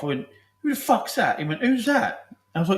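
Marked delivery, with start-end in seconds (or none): 0.6-1.4 a sudden high yelp in the dark; startled captain. Retold or imitated. Captain woken, calls out. 1.6-2.3 high, loud startled shout; a sudden high yelp in the dark; startled captain. Retold or imitated.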